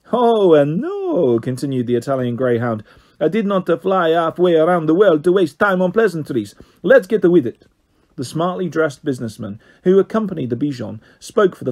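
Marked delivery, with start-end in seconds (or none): 0.1-1.4 Italian voice. A narrator's reading. Italian greyhound objects. 3.2-7.7 put-on higher Italian accent, impatient; Italian greyhound. A narrator's reading.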